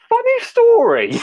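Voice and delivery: very high, loud, drawn-out singsong; awkward confession. Retold or imitated. Buyer confessing to the seller at a con, awkward. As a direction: stretch it out in a comically high voice.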